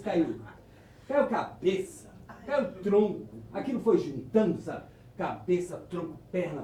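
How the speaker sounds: ordinary delivery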